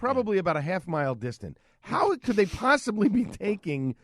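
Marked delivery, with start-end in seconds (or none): 1.8-3.9 drawn-out, incredulous question; slow, emphatic disbelief. Real, not acted. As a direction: spell it out very slowly, word by word. Incredulous repeat of his question.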